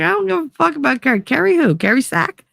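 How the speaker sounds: high, fast mock outrage; incredulous. Hearing who he's with, high incredulous outburst. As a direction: react in shocked disbelief.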